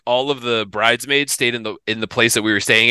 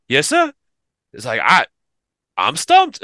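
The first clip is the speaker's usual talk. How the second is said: southern dude voice; 3 semitones higher than usual. Retold or imitated. Colin telling Pete he is stumped, high amazed Southern voice. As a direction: play Colin completely baffled. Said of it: "big old dude from the South".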